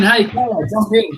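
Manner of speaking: usual speaking voice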